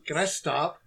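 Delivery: normal talking voice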